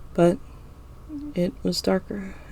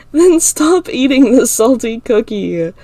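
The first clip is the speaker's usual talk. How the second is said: through laughter; 4 semitones higher, 6 dB louder, slower than usual. A narrator's reading. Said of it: "I say through laughter and tears".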